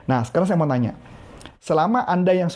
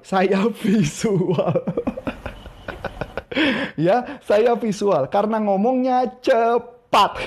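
laughing; 2 semitones higher, much slower than usual. Real, not acted. Laughing at himself.

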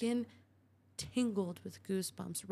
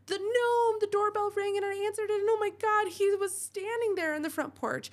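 very high, loud, fast panic; terrified wife shrieks to Jim about the gnome, hysterical; 12 semitones higher, 6 dB louder than usual. Acted. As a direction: shriek it out in terror.

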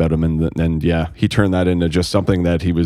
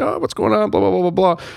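chirpy greeting; 13 semitones higher than usual. Retold or imitated. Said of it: "I'm like".

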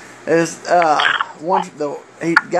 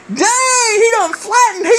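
high, laughing fan's cry; mock fan, laughing; 13 semitones higher than usual. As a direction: squeal it through laughter like an amazed fan. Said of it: "Somebody in front of me goes".

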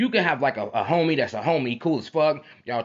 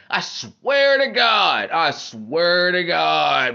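"swear" stretched very long and slow; exasperated oath; 3 semitones higher, 4 dB louder, much slower than usual. Real, not acted. Swearing to listeners that's how it be, drawn-out exasperation. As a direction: drag out "I swear to God" with heavy emphasis on "swear".

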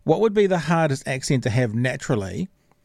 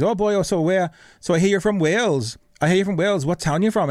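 higher-pitched imitation of a Welshman; Welsh accent; 6 semitones higher than usual. Film extra asking a fellow actor his town, in a Welsh accent. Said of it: "in his Welsh accent says".

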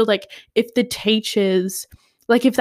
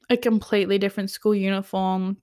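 ordinary talk, nothing marked

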